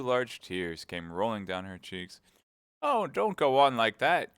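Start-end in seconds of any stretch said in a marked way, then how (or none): 2.8-4.4 higher and much louder, despairing queen; despairing cry. A narrator's reading. The Queen begging Alice not to weep, despairing cry. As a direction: cry out, wringing your hands in despair. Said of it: "cried the poor Queen, wringing her hands in despair".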